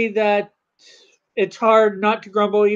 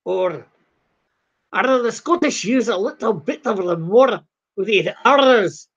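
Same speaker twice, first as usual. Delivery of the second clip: put-on Scottish accent with strong Rs. Shown as a demonstration. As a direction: demonstrate a Scottish burr. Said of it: "Oh, Scottish and Irish".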